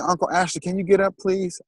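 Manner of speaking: put-on pleading like a five-year-old asking for cereal; little nephew. Nephew voiced again.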